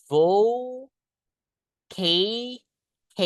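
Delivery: "Vo", then "K", each stretched and set apart; syllable by syllable. Shown as a demonstration. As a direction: sound out "Vo... K" slowly with long gaps. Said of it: "Bacanya gini, guys".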